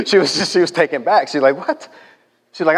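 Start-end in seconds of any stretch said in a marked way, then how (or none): 1.6-1.9 shocked what. Retold or imitated. Lady taken aback by insult.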